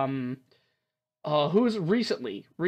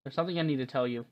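soft, low visual novel reading; understated read-aloud, hushed toward the end; story narration; 3 semitones lower, 4 dB quieter than usual. Acted. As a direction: read the dialogue gently and quietly.

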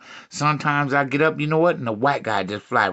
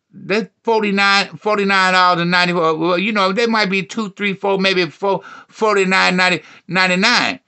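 raised pitch, loud stumbling count; imitated rambling woman; 5 semitones higher, 5 dB louder than usual. Woman guesses the curlers' price, loud rambling imitation. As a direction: ramble through numbers high and loud.

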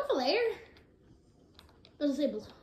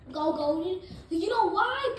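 ordinary talk

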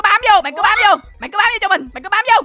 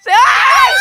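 high, loud call; 13 semitones higher, 5 dB louder than usual. Real, not acted. Player calling a teammate for help, high shout. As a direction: shout the name across the room.

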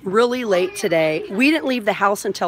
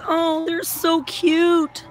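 cooing; 8 semitones higher, much slower than usual. Real, not acted. Cooing over water baby dolls.

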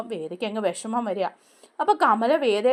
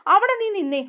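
stern call; 9 semitones higher than usual. Retold or imitated. Kamala stops Radha.

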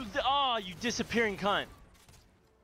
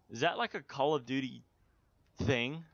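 usual speaking voice

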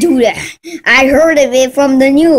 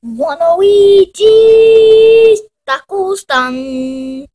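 slow announcement; 6 semitones higher, slower than usual. Acted. Announcing the taco stand.

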